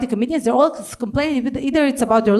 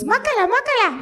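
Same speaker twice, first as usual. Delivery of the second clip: mimicked stranger; 10 semitones higher, faster than usual.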